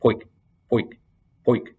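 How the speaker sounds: short sharp poik snaps; dry snapping sound. A narrator's reading. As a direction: imitate laces snapping in a row. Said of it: "he heard dry snapping noises".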